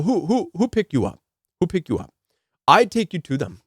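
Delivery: imitating a Haitian accent, insisting; a light Haitian accent, badgering a traveller. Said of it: "I'm gonna do my best to do the accent".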